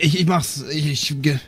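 ordinary talk, nothing marked

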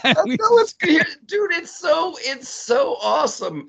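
high, delighted gushing; squealing with delight; excited. Real, not acted. Delighted reaction to impression.

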